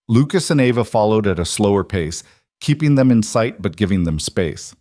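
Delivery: ordinary delivery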